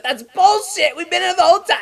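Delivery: high, loud mock-indignant protest; outraged passenger. Late family's woman protesting at the gate, mock outrage. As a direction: complain shrilly at the airline staff.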